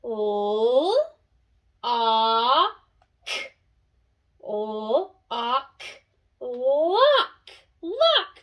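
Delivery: letters sounded apart, then Lock blended; sounding out. Shown as a demonstration. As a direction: stretch each sound, then snap them together. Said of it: "Let's all say it together".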